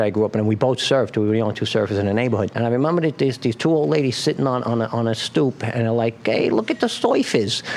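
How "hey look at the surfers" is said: put-on old lady with a thick Brooklyn accent; high, thick Brooklyn old lady. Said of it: "in a real thick Brooklyn accent".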